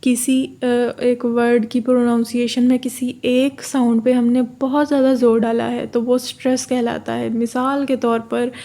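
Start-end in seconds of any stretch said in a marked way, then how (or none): none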